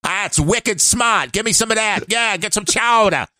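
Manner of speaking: hammy wicked-smart Boston voice; Boston accent. Pretend. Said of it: "racist against people from New England".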